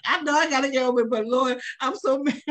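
high, fast, laughing through a mock gripe to the Lord; half-laughing confession of being mad; laughing mock complaint. Friend admits to the others she stays mad, laughing. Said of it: "I'm like".

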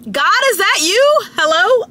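high, eager call to God; mock amazed. Mock prayer answered by text.

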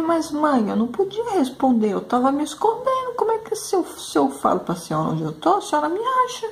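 high, childlike pleading voice, mildly mocking; imitating her little son. Mother retelling her son's excuse, in a high kid voice. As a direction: play the kid innocently explaining his hiding.

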